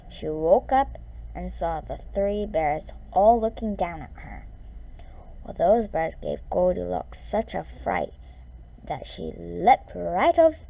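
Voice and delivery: ordinary delivery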